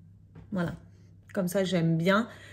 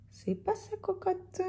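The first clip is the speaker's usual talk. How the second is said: high teasing voice; 11 semitones higher, 8 dB quieter than usual. Pretend. Answering her own remark, teasing.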